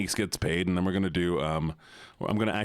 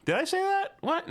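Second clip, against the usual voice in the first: very high, feigned disbelief; mock innocence. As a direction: squeak it out as fake denial. Feigns innocence over own earlier remark.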